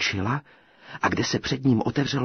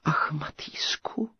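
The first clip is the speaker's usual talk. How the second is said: breathy tearful sigh; 7 semitones higher, slower than usual. A narrator's reading. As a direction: sigh his name in despair. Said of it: "vzdychla knížecí dcera".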